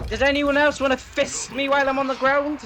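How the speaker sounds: high loud sarcastic outburst; exasperated yell. Real, not acted. Streamer downed on the ground, yelling sarcastically. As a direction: shout your frustration at the game.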